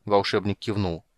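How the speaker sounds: usual speaking voice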